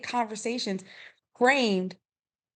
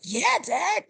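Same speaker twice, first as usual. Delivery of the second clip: put-on high-pitched keen child's reply; imitated son, pitched up; eager little boy; 9 semitones higher, 3 dB louder than usual. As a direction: answer Dad like an eager kid. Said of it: "And he's like".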